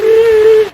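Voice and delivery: high, loud mock wail; imitated wail. Tourist crying at the offer of gelato, mock wail. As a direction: burst into an exaggerated sob. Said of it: "I was crying so hard".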